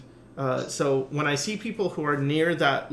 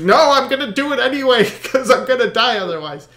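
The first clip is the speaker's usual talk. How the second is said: loud, high determined cry breaking into laughter; mock defiance through a laugh; laughing resolve; 8 semitones higher, 9 dB louder than usual. Rower finds willpower again.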